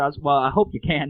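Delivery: ordinary talk, nothing marked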